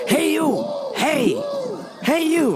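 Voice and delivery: high-pitched calling out; mock yelling. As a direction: imitate a customer hollering for attention. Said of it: "this guy was just yelling".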